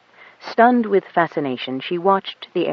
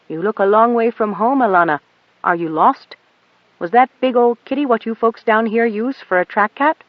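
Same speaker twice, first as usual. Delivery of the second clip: folksy pilot, louder, easy and kind; friendly pilot; 6 semitones higher, 4 dB louder than usual. A narrator's reading. The pilot greets the lost girl kindly. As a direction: reassure a lost kid with a grin.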